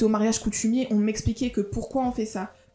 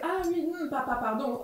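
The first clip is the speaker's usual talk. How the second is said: lightly demonstrated Gabonese accent, soft and higher; switched-in Gabonese accent; 5 semitones higher, 4 dB quieter than usual. Shown as a demonstration. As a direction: slip softly into a Gabonese accent. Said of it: "un peu l'accent, je tourne la tête".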